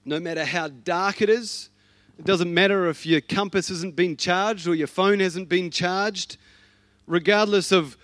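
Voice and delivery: loud, rising, passionate sermon delivery; full force preaching. Acted. Preacher urging the congregation to reimagine their faith, full force preaching. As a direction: build it up like a revival call.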